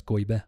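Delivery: "goybe" with an oy glide and no r; Turkish way. Retold or imitated. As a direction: imitate the Turkish "goybe", r softened to y. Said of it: "what is the Azeri or Turkish word".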